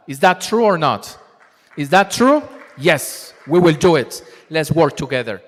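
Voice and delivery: loud, rousing call to the room; loud rallying question to the audience. Real, not acted. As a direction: fire the crowd up to answer back.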